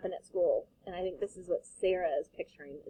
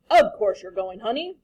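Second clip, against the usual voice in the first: firm father; 9 dB louder than usual. Acted. Father insisting.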